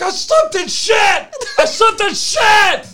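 high, full-force horrified shout; screaming. Retold or imitated. Friend on the phone after slipping, screaming. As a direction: imitate a friend screaming, last word stretched out. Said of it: "then he starts screaming on the phone".

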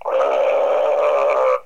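a low, rough growl from deep in the throat; dog growl. Retold or imitated. Imitating the furious dog's deep growl. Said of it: "He's going".

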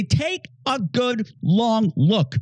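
very slow, weighty warning; slow solemn challenge. Pretend. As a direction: draw it out like a stern mirror. The mirror speaks to society.

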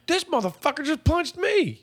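high-pitched, drawn-out protest; mock outraged cry. Acting the man punched at a funeral, high outraged cry. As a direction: wail the complaint high and slow.

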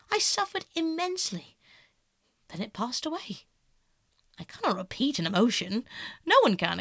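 put-on airy, animated young aesthete; breezy, theatrical self-justification; self-absorbed Dorian. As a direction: hold forth with sweeping, lilting pitch. Voices Dorian's long speech.

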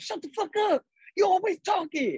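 high-pitched mock-aggressive shout; Lulu snapping. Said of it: "you told that person".

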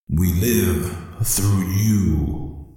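very slow, eerie spirit voice; ghostly warrior. Acted. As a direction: drag it out like a voice from the grave. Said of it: "spirit Tyle answers".

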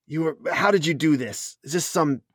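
shocked NPC woman. Acted. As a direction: lightly voice her disgust and disbelief.

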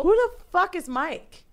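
high, drawn-out incredulous outburst; who-on-earth disbelief; retold disbelief. Retold panicked reaction to stranger's name.

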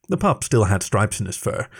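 ordinary delivery

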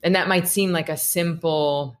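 ordinary delivery

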